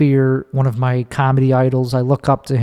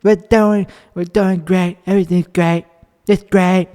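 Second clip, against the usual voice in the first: raised-pitch political caricature in short bursts; Trump; 5 semitones higher than usual. Retold or imitated. Comic showing whom he starts to sound like, presidential impression. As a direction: do the president's voice, higher, in separated phrases. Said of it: "I even start to sound like Trump's".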